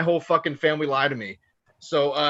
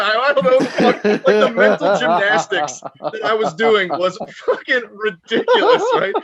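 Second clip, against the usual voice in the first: real laughter breaking through, louder and higher; words squeezed out through laughter; laughing; 5 semitones higher, 7 dB louder than usual. Real, not acted. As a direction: laugh helplessly at your own mental gymnastics. Cracking up at his own logic.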